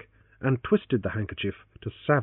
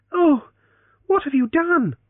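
shocked; 6 dB louder than usual. Acted. She discovers the tourniquet.

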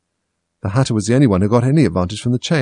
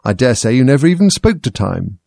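contemptuous; 4 semitones higher, 6 dB louder than usual. A narrator's reading. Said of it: "tossing his head contemptuously".

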